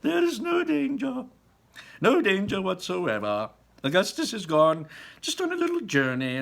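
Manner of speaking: high, loud breezy reassurance; high loud cheerful Wonka voice; cheery Wonka. Acted. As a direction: brush off the danger brightly. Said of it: "Wonka reassuring Mrs Gloop".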